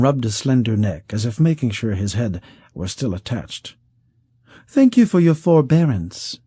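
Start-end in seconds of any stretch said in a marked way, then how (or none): 4.7-6.4 high, smooth, coaxing voice of a wealthy schemer; silky rich man. Acted. Wealthy schemer coaxing the outlaw, high and silky. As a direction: purr it out like a sly patron who holds all the cards.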